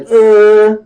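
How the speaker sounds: put-on high, loud childish jeering noise; tongue-out taunt. Little girl taunts Usopp over the goggles, childish tongue-out jeer. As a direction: stick your tongue out and jeer, high and drawn out. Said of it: "tongue out with".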